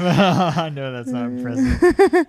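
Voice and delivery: laughing through the words. Real, not acted. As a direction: say it while laughing. Laughing while talking.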